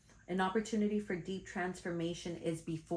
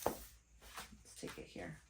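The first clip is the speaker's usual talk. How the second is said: low whisper; 14 semitones lower, 12 dB quieter, much slower than usual. Real, not acted. Pulling the next card.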